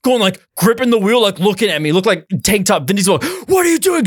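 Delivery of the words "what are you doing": mock scream. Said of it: "screaming at me".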